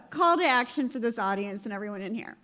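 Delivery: ordinary talk, nothing marked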